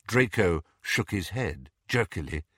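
ordinary delivery

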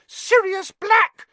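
very high, loud, drawn-out shriek. A narrator's reading. Mother pointing at a wanted man, shrieking. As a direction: shriek the name in alarm. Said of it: "she shrieked".

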